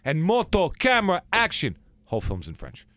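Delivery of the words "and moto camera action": loud, high shout of moto, camera, action; calling set cues. Retold or imitated. Director calling the start of a take, shouted high and loud. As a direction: bellow it like a film set call.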